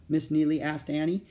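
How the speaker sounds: ordinary delivery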